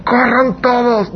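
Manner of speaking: hammed-up cry of a leader who gives up; panicked leader. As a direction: leader telling the team to run, in mock panic. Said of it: "Tú vas a ir al equipo".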